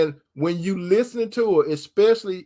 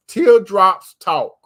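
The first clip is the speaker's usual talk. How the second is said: slow emphatic preaching; 9 dB louder, much slower than usual. Acted. Preacher stresses a key line.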